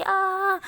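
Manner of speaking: sudden high loud shriek of fright; startled scream. Acted. Aashi touches her friend's hand and screams in fright. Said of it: "जोर से चिल्लाती है".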